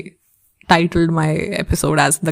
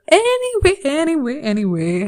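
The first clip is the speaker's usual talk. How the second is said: playful descending anyway; 7 semitones higher than usual. Pretend. Playful stepping down on repeats.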